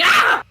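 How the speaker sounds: sudden startled yelp. Acted. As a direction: yelp as if jump scared. GM acts vampire jump scare.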